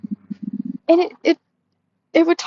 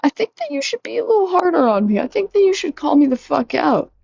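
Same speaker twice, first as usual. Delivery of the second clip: much higher, faster pleading retort; exasperated plea; 8 semitones higher than usual. Retold or imitated. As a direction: beg the bot to be harsher, exasperated. Her own retorted plea quoted.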